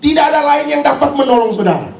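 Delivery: high-pitched, emphatic preacher delivery; full-force preaching. Real, not acted. Preacher telling the congregation no doctor cures leprosy, full-force preaching.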